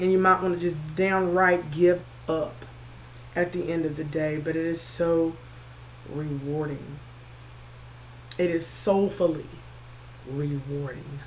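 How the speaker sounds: low, very soft, with pauses; hushed sincerity. Real, not acted. Reassuring listeners that hard growth pays off, hushed and sincere. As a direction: let it sink to a quiet, heartfelt close.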